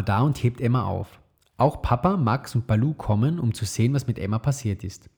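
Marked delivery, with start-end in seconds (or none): none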